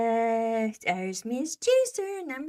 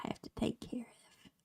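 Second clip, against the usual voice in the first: whisper; 3 semitones lower, 8 dB quieter, faster than usual. Real, not acted. Whispered trailing aside.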